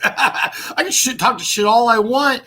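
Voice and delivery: ordinary talk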